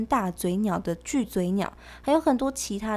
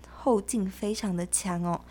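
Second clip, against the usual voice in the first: ordinary talk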